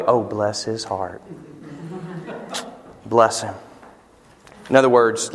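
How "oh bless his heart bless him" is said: soft, sugary Southern sympathy, lightly done; lightly mocking Southern bless-his-heart; mock Southern pity. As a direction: say it like a sweet Southern lady pitying someone. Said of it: "We also use it in a different way in the South".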